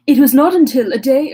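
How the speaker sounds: trying out a new voice for Mister Kerwin; changed voice for Kerwin. Shown as a demonstration. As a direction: test a different character voice. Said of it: "I'm gonna change his voice".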